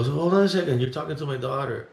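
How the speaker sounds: retold indignant outburst, pitch jumping up; indignant protest. Retold confrontation with the cop.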